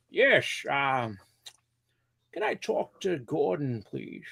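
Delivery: mock caller in a training call; slow put-on caller voice. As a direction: stretch it out like a goofy customer.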